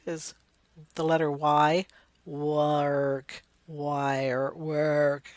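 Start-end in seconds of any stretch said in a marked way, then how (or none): 2.3-5.3 "Work", "Wire", "Work" each drawn out; sounding out. Shown as a demonstration. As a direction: stretch the opening w of "Work" and "Wire". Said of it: "Y sounds like the W sound".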